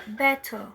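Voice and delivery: better com T claro e final em A, bɛta; British better. Shown as a demonstration. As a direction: diga better com T forte e fim aberto em A. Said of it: "tem um som de A". (better britânico)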